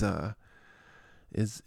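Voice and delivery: usual speaking voice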